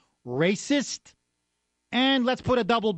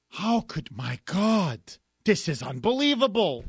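mock shock. Sarcastic mock shock again.

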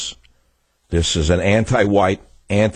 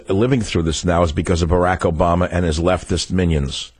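normal talking voice

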